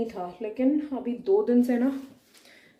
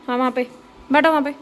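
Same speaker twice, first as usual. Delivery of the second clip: louder, pointing the dog to its spot; firm direction to pet; 3 semitones higher, 7 dB louder, much faster than usual. Real, not acted. Owner guiding Jini to the spot, firm and loud. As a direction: insist the dog sit there.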